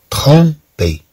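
slow trompé, split in two; syllable-by-syllable trompé. Shown as a demonstration. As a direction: separate "trom" from "pé". Teacher breaks word into syllables. (نطق trompé ببطء مقطعًا مقطعًا)

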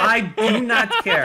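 loud, high, slow, every word hammered; defiant shout. Real, not acted. Dan defies friends who say he misspoke, loud defiant shout.

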